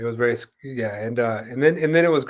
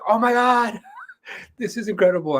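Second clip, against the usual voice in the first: amazed; 10 semitones higher than usual. Retold or imitated. Retelling amazement at the medicine man.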